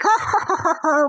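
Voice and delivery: mock chortle; imitated laughter. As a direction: put on other people's hearty laughter. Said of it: "that's the laughter that they would do".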